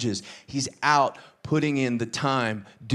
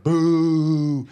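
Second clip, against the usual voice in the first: a loud, playful booing jeer; mock audience boo; 2 semitones higher, 6 dB louder than usual. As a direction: boo him like a crowd at a villain. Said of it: "we're like".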